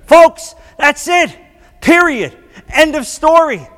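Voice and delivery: high, forceful preacher's punch; emphatic finality. Real, not acted. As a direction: land each short phrase as final. Preacher drives the point home.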